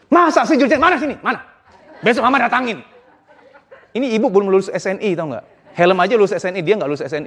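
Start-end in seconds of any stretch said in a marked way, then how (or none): 0.1-2.9 high, quick, hammed-up outrage; indignant mother. Immature mother reacting to her child's report, indignant. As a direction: bristle on the child's behalf, pitched up. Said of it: "ibu-ibu yang belum lulus jadi ibu, ngomongnya gini".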